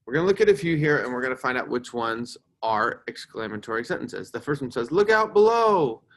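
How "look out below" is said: high, loud, drawn-out call; exclaimed warning. Shown as a demonstration. Teacher reads an example to the class, shouted warning. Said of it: "Do you think that's exclamatory".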